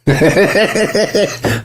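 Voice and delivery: high, helpless giggle; laughter. Real, not acted. Cracking up at friend's line.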